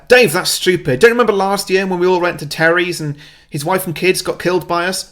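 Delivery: exasperated rebuke. Acted. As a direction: tear down a foolish suggestion in exasperation. Leader scolds Dave at the meeting.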